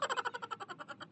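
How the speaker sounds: high, soft mischievous laugh; daughter-in-law giggles at her own prank; sly giggle. Acted. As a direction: giggle at your own trick.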